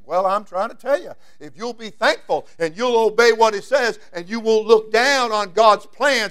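normal talking voice

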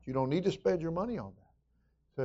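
ordinary talk

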